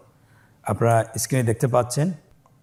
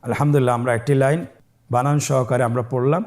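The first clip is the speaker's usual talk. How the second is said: ordinary talk, nothing marked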